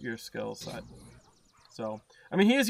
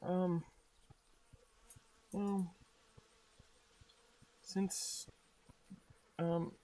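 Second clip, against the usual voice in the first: hushed hesitant voice; 9 dB quieter, much slower than usual. Acted.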